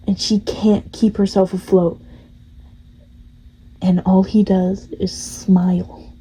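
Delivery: ordinary talk, nothing marked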